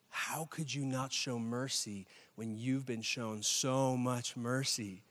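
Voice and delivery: slow, low-key reproach with weighted words; grave rebuke. Acted. King to the unforgiving servant, slow grave rebuke. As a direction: rebuke quietly, drawing out each word.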